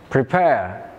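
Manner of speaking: "prepare" said pre-PARE; "prepare" with a light start and weight on "pare"; second-syllable stress. Shown as a demonstration. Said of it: "It has stress on the second syllable".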